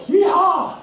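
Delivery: high, loud joyful yeehaw; mock cowboy yeehaw. Rider cheering as he mounts the horse, high loud whoop.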